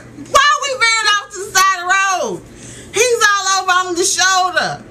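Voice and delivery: high-pitched, disbelieving outcry; alarmed protest. Retold or imitated. Passenger as truck veers to the shoulder, alarmed protest. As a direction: exclaim in mock panic.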